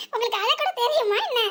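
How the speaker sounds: ordinary talk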